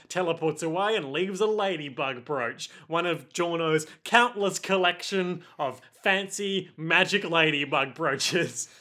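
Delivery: normal talking voice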